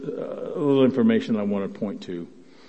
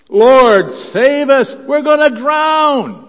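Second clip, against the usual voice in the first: high, loud, desperate cry for help; shouted plea; 13 semitones higher, 12 dB louder, slower than usual. Retold or imitated. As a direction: cry out like drowning men. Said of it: "it says they shouted at him".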